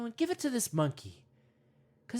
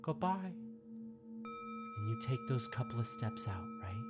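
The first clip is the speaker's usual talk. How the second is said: guided meditation; 5 semitones lower than usual. Acted. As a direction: ease the listener in gently, mock-serene.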